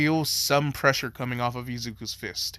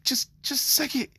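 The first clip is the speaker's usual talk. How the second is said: high voice; 12 semitones higher than usual. Retold or imitated. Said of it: "Go, like".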